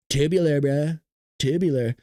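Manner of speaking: put-on SoCal surfer-bro accent at an easy pitch; laid-back California dude parody; Southern California surfer. Surfer to his buddy about the waves, California surfer accent. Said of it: "we have our own accent over here".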